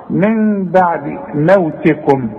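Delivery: من بعد موتكم slow with every م said clearly; clear meem. Shown as a demonstration. Teacher modelling clear meem slowly. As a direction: give the م in موتكم a clear unmerged sound. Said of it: "لازم نظهرها".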